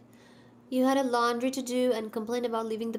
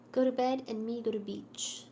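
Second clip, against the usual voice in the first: usual speaking voice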